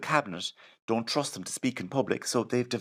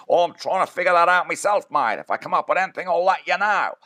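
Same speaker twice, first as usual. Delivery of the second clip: loud, high Australian voice; boomy higher Australian mogul accent; Rupert Murdoch; 8 semitones higher, 9 dB louder than usual. Retold or imitated. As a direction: bellow it in a broad Australian accent. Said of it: "He said".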